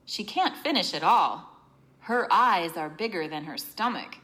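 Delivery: ordinary talk, nothing marked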